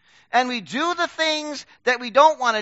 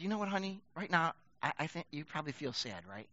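put-on soft and much quieter, tender, to a crying child; gentle comfort; 6 semitones lower, 14 dB quieter than usual. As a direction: soothe her softly, almost hushed.

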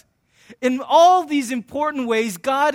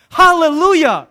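loud, higher hallelujah cheer; exultant shout; 5 semitones higher, 9 dB louder than usual. Acted. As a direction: shout it like a victory cry. Mock cheer for a team win.

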